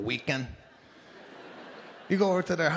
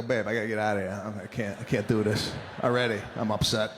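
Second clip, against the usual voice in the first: low, fast, half-hearted panic; mock bothered whine; 8 semitones lower than usual. Husband begging wife to leave spin class, mock upset. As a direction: play it as a lightly whiny husband. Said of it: "I'm bothered".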